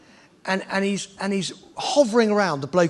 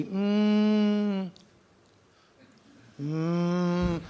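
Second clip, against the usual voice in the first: smooth engine hum; 4 dB quieter than usual. Shown as a demonstration. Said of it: "When actually it should be".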